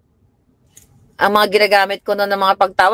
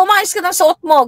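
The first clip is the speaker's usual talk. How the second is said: scolding; 11 semitones higher than usual. Retold or imitated. Mother scolding.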